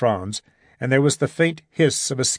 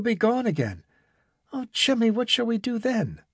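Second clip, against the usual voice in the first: lightly voiced worried girl, higher; a hint of a lovesick young woman fretting; young woman Irene, fretful; 7 semitones higher than usual.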